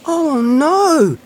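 very high, loud, drawn-out wail; dismayed cry. A narrator's reading. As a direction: cry out in alarm at the loss. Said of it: "cried Little Hedgehog".

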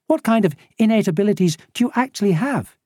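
high, quick questioning voice; inquisitive Nilly. A narrator's reading. Nilly quizzing the smuggler on his abilities, high and quick. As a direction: pitch it up and rattle off the question.

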